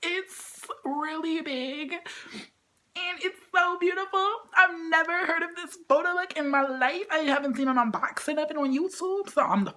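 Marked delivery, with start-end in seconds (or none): 0.0-6.5 gushing awe. Real, not acted. Gushing about photobook.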